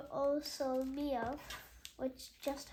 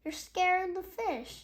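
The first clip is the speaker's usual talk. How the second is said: put-on annoyed girl; 6 semitones higher, 7 dB louder than usual. Girl scolding from boat.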